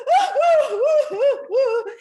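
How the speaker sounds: very high, loud laughing woos; ecstatic woo. Retold or imitated. Author whooping to her daughter, overjoyed. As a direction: whoop with joy through laughter.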